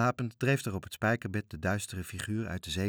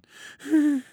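high, loud whimpering wail; whining wail; 8 dB louder than usual. Acted. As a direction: let out a miserable whine. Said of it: "bleef jammerend staan". (hoog jammerend gejank)

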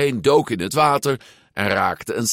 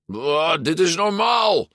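high, strained yell; bellowed; 10 semitones higher than usual. A narrator's reading. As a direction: holler while careering downhill. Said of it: "brulde Alberto". (brullend, met hoge stem)

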